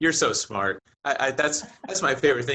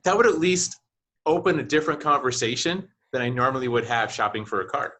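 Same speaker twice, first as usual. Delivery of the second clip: usual speaking voice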